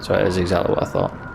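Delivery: ordinary talk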